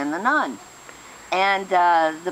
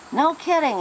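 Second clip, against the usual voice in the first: surprised; 10 semitones higher than usual. Acted. Priest surprised at the news.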